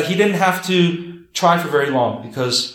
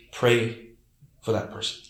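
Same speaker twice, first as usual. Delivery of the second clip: soft counsel; 6 semitones lower, 6 dB quieter than usual. Shown as a demonstration. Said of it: "I say".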